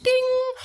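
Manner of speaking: high flat electronic ding; a sharp ding, like a machine notification; chime sound. Acted.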